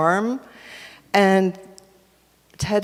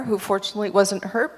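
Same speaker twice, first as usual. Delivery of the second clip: ordinary delivery, nothing marked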